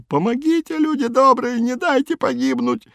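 very high, desperate whine; wailing plea. A narrator's reading. Man sinking in mud begs for help, high wailing plea. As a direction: wail high as if going under. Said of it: "и вопил".

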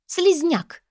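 high, scornful outburst; angry cry. A narrator's reading. Sister insulting her lovesick brother, crying out. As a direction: spit the insult out at high pitch. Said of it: "выкрикнула Амалия".

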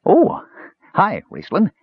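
high, startled squeak of a greeting. Acted. Kender startled by the mage, high surprised hello. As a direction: caught red-handed, blurt it out high.

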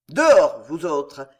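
one very loud command, then controlled; calm, biting shout. A narrator's reading. Tenant clearing the servants from his room, biting shout. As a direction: order them out, cool and cutting. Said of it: "cria-t-il de sa voix calme et mordante".

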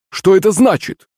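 high-pitched indignant question; angry outcry. A narrator's reading. Захарыч asked to play along, angry cry. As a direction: demand an answer, pitch jumping up. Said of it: "гневно вскрикнул Захарыч".

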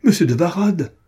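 loud, high, appalled exclamation; scandalized outburst, voice leaping up; outraged cry. A narrator's reading. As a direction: cry out in shocked indignation. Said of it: "s'écria-t-elle".

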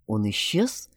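low, subdued melancholy; melancholic. A narrator's reading. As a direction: say it softly and low, with resigned gloom. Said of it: "меланхолично заметил Ромашка".

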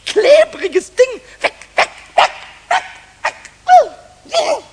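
frantic and loud, fighting the sticky thing; desperate cries of disgust; panicked struggle. Acted. Goblin stuck on chewing gum in the bin, panicked cries.

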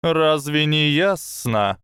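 high, slow serpent voice; a hissing snake, slow and sly. Acted. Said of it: "прошипела змея".

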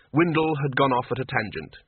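usual speaking voice

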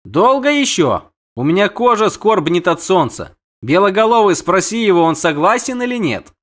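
high-voiced, impatient siren. Siren presses the witcher for a reply, impatient. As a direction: demand an answer in a high voice.

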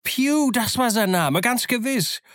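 high-pitched excited confirmation. A narrator's reading. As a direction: cry it out, pitch soaring. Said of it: "rief Silver jetzt ganz aufgeregt".

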